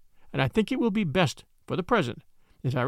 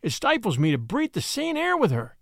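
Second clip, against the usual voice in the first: anguished, pitched-up horror; horrified outburst; 6 semitones higher than usual. Acted. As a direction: recoil in horror and beg to leave. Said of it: "with a scream of horror".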